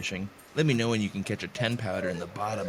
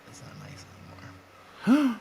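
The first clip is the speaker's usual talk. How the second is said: whisper; slower than usual. Pretend. Whispered after bleep remark.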